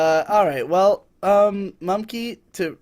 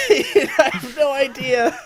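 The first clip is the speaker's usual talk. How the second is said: laughing; 9 semitones higher, slower than usual. Real, not acted. Laughs, can't remember his side.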